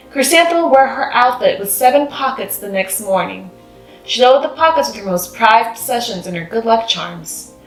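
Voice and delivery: ordinary delivery, nothing marked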